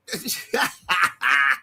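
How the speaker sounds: real burst of laughter; helpless mocking cackle; derisive laugh. Real, not acted. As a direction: crack up mid-rant. Cracks up at the merch.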